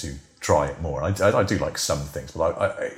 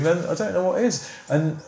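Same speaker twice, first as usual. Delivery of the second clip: ordinary talk, nothing marked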